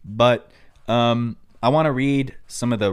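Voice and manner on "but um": normal talking voice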